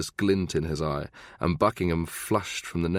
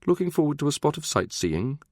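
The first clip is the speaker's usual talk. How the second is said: high-pitched mock-posh voice; sarky upper-class accent, pitched up; affected posh accent; 9 semitones higher than usual. A narrator's reading. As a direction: needle him in a put-on posh accent. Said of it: "affecting a posh accent".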